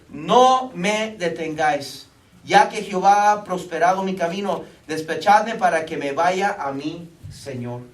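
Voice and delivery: a hint of the insistent servant, drawn out slow and loud; preacher voicing the servant as he reads it slowly; slow solemn servant. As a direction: read the servant's plea slowly, with weight. Servant quoted from scripture.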